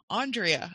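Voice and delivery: "Andrea" as ON-dree-uh; broad "on" start to "Andrea", stressed first; variant "Andrea". Shown as a demonstration. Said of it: "people will call me".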